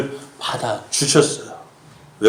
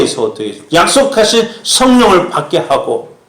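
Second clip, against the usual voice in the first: raised, high-pitched sermon delivery; loud, driving pulpit proclamation; fervent preaching; 9 semitones higher, 4 dB louder than usual. Real, not acted. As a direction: preach it out with mounting force. Preaching at full force.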